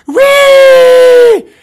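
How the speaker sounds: imitating a wild animal's squeal; monster shriek. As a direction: shriek like a gleeful beast. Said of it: "I have monsters going".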